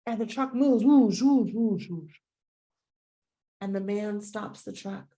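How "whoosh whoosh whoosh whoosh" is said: whoosh. Retold or imitated. Truck rushing past sound.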